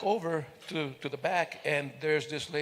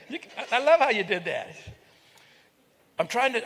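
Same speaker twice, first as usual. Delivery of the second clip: ordinary delivery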